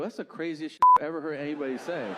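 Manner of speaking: loud, fast disbelieving rant; mock-exasperated loud retort; incredulous outburst. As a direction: blurt it out in disbelief. Retorting to absurd bank guy.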